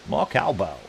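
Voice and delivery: hammed-up catchphrase in a badly done impression; Christopher Walken. Player quoting a catchphrase to himself, bad impression. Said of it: "It's a terrible Christopher Walken impression".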